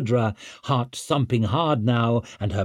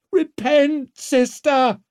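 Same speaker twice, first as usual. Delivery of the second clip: high, loud zealot's shriek; screeching; 5 dB louder, slower than usual. A narrator's reading. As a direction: shriek it at a passer-by like a fanatic. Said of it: "she was screeching".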